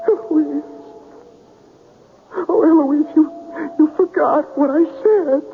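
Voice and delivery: a man torn apart by grief; anguished. Acted. Man grieving dead love.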